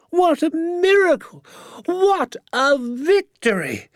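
very high, slow, theatrical rapture; jubilant crying out. A narrator's reading. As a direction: proclaim it in high, stretched-out ecstasy. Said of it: "he cried, pumping my hand up and down so fiercely".

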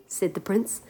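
ordinary delivery, nothing marked

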